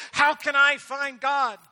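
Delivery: high, loud, slow earnest plea; pleading young man; young seeker. Acted. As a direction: ask it like a desperate seeker. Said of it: "and he said".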